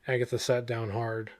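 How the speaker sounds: plain reading voice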